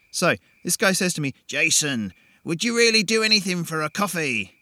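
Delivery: put-on chatty young man, a bit full of himself; Jason, breezy storyteller. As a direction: tell it like a cocky guy spinning a yarn. Jason's character voice opening tale.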